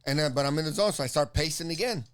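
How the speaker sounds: normal talking voice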